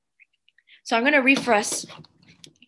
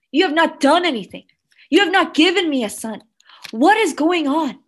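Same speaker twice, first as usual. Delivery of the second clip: loud, acted-out protest to God; bitter, angry prayer; 5 dB louder than usual. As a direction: pour out years of bitterness at God, loudly. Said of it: "she wept with anger".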